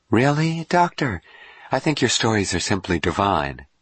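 high-pitched woman, gushing; gushing admirer. Acted. Dawn flatters the famous doctor, gushing.

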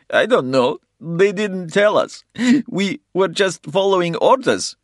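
high, loud, panicked plea; frightened pleading. A narrator's reading. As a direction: plead innocence in fear. Captive pleads.

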